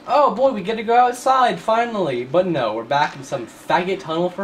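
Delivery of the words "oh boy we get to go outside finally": higher-pitched mock excitement; imitated eager player. Gamer voicing the viewer glad to get outside, mock excitement. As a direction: play the hopeful player, hammed-up. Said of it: "you were like".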